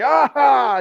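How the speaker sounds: high yelled sneeze; dad sneeze scream. Retold or imitated. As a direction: holler out a sneeze. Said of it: "screaming, like".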